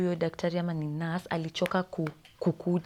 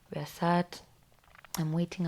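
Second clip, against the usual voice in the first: ordinary talk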